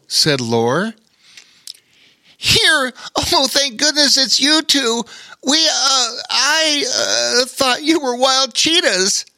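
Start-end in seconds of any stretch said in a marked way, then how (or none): 2.4-9.2 high, tumbling relieved otter voice; stammering with relief. A narrator's reading. Otter relieved to find his friends, high and stammering. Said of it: "said a much relieved Bobo Chico".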